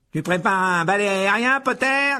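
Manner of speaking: high, loud drawn-out taunting yell; jeering shout. A narrator's reading. Malfoy jeering at his rival mid-flight, high shout. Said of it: "cria Malfoy".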